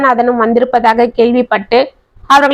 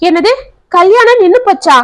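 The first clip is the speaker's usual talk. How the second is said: higher, shocked question; 7 semitones higher than usual. A narrator's reading. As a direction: gasp it out in disbelief, pitched up. Shocked at the cancelled wedding.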